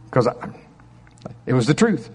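ordinary talk, nothing marked

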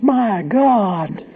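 high, shocked mock cry; high-pitched imitated outcry; imitated yell. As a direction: mimic a horrified woman's yell. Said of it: "this woman yells".

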